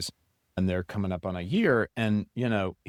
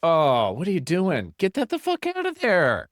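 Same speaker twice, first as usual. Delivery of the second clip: mock outrage; 8 semitones higher, 4 dB louder than usual. Mock outrage at laptop in bed.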